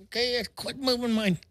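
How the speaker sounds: high mock whine of a grown man; whining like a girl. Mocking the towel guy as he whines at the beach. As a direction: imitate a big guy whining like a little girl. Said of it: "He's whining like a little girl".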